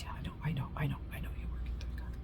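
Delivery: fast, hushed whisper; mother's whisper. Retold or imitated. Mother whispering agreement to her tired child. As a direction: rush it out in a whisper. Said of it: "She's like".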